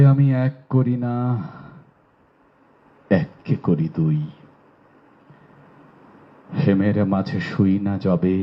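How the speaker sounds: slow, measured Bengali verse recitation with long pauses; poetry recitation. Shown as a demonstration. Teacher recites a short poem to the online class, slow poetry recitation. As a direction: recite the poem slowly, drawing out the line endings. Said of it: "একটা কবিতা আবৃত্তি করে শোনাই".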